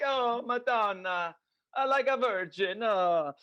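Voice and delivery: hammed-up Sicilian crying out to the Madonna; old Sicilian exclamation. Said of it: "they used to be like".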